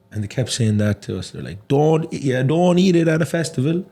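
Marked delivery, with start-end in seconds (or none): 1.7-3.8 high, sing-song Cork mimicry; exaggerated Cork lilt; strong Cork accent. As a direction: imitate Cork lads insisting. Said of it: "their accent is so strong".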